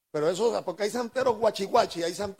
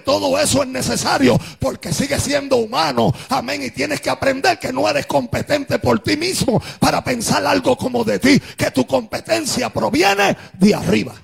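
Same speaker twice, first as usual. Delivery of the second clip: driving, chanted sermon cadence; full-force preaching; 4 semitones higher, 8 dB louder than usual. Acted. Preacher exhorting believers through their struggles, full-force preaching. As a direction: proclaim each phrase with sustained force.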